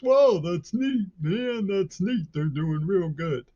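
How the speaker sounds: very high, slow, spaced-out mock awe; dopey musician impression. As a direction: drag it out like a clueless stoner. Mocking [name]'s dopey reaction.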